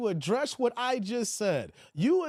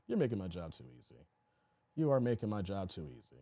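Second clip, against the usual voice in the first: lower, softer repeat; 12 semitones lower, 6 dB quieter, faster than usual. Shown as a demonstration. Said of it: "repeated, much lower and quieter".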